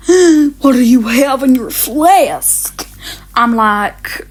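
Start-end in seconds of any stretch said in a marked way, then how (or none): 0.1-2.9 slow, loud, aghast teacher voice; shocked teacher. Retold or imitated. Teacher spotting the tea in the flask, slow shocked demand. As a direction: drag it out like a scandalised teacher.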